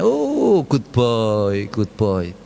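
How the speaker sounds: pat him and coo praise; praising mother. Acted. Parent praising son.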